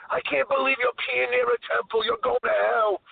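a teasing scolding buddy voice; mock-scold him like a pal; teasing friend. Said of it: "Mike was busting my balls".

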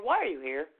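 ordinary talk, nothing marked

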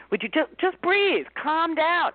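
sing-song advice from a know-it-all; mock helpful advice. A helpful friend telling an upset person, patronising. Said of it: "somebody really helpfully says".

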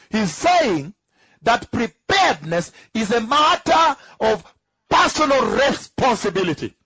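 high, slow, loud preaching on "preparedness"; emphatic preaching. Acted. Preacher proclaiming to the church, slow and emphatic. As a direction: stretch "preparedness" up high, then hammer "personal responsibility".

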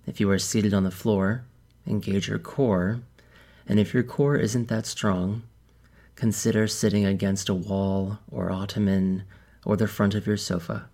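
calm, measured meditation guide with long pauses; softened, even delivery, leaving space between instructions; guided meditation. Real, not acted. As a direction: ease listeners into stillness, phrase by phrase.

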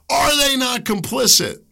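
high, loud, near-cracking demand; impassioned outcry. Real, not acted. Host condemning Nazi-era complicity, anguished loud cry. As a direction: hurl the question with anguish. Rant about complicity peaks.

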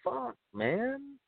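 rising, incredulous what the fuck, climbing high; shocked outburst. Retold or imitated. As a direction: retell your disbelief at the eulogy, half acted. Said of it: "And I'm going".

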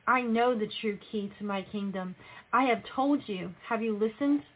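ordinary delivery

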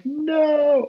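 anguished no. Retold or imitated. Pitcher watching his first homer allowed, high dismayed no. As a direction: cry out in dismay. Reacting to the home run.

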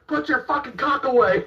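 high, loud barked order from the driver; retold angry shout. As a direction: yell it like a fed-up bus driver. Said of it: "he goes".

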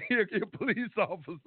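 words broken up by real laughter; can't keep a straight face introducing him; laughing through it. Real, not acted. Introducing his homeboy to the police, laughing.

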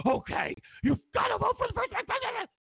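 very high, squealed caricature of nagging; mock insistent squeal. Pushy friend pressing a voter, high mock squeal. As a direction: press the demand in a squeaky put-on voice.

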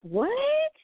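sudden high startled what; jolted by something on screen; a real startled squeak of surprise; surprised what. Real, not acted. Confused by chat room.

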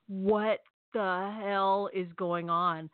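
very slow, dumbfounded question; stunned. Retold or imitated. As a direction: drag each word out, as if winded by shock. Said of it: "felt like someone hit me in the stomach".

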